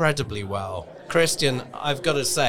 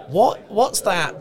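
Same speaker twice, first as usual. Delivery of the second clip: puzzled; 9 semitones higher, 4 dB louder, slower than usual. Pretend. Host voices imagined hesitant reply.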